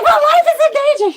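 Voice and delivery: high loud rushed cry, laughing; desperate shout. Retold or imitated. Woman pleading with store clerk, high loud and laughing. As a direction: yell it at the clerk in disbelief.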